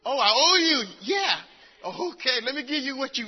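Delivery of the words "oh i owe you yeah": loud sarcastic "oh, I owe you"; sarcastic shout. Retold or imitated. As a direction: throw it back at them with a sarcastic shout. Mocking the entitled youth.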